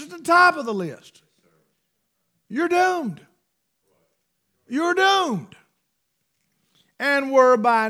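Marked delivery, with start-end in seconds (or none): 2.5-5.6 high, ringing doom, said twice; preacher crying out doom. Real, not acted. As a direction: proclaim it like a sentence passed.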